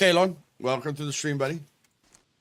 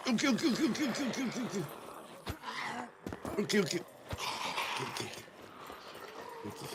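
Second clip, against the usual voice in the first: breathless, soft, higher, fading okays; panicky whisper; 4 semitones higher, 12 dB quieter, much faster than usual. Real, not acted. Player cornered by enemies, breathy panicked okays. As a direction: mutter it in rising panic. Panicked muttering under pressure.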